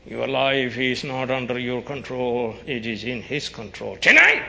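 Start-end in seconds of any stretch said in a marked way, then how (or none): 4.0-4.4 sudden high loud shout. Acted. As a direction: yell the word out. Preacher shouting a warning.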